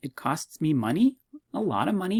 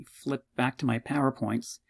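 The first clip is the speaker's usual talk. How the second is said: ordinary talk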